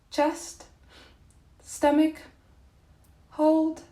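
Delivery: high, loud words on a full breath; holding breath. Real, not acted. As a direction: squeeze the words out on held air. Talks while holding an inhaled breath.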